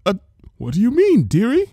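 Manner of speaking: high, fluttery baker's voice; a startled sweet old baker; high and nervous, drawing out dearie; nervous Mrs Cake. Acted.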